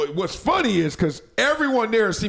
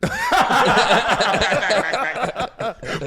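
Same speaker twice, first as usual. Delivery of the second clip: long hearty laugh. Real, not acted. Reacting to sister mention.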